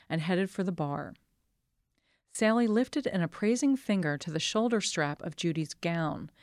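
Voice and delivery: ordinary delivery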